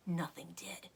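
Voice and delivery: hushed narration; whispered relief; suspenseful whisper. A narrator's reading. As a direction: breathe it, tense.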